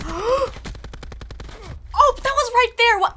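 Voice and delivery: high, loud, slow frustration; exasperated disbelief. Real, not acted. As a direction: wail over the missed kill. Near miss in game.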